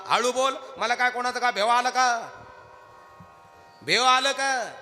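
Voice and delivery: 1 defiant retort. Acted. Old woman defies being hushed.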